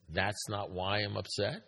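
"That's not why I'm upset" slowly, rising on "upset" into a question; doubting question. Retold or imitated. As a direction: ask it as if doubting yourself, lifting "upset" at the end. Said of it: "that's like saying, "Maybe I'm wrong".

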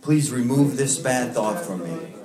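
a gentle, unhurried prayer spoken slowly. Acted. Leads group in prayer.

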